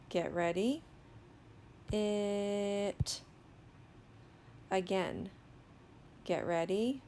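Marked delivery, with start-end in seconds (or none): 1.9-3.2 "It" with the vowel held long; slow sounding-out. Shown as a demonstration. Teacher models reading for children, "It" drawn out slowly. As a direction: stretch "It" slowly, one level tone. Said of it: "saying the word slowly".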